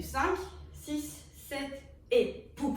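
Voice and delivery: usual speaking voice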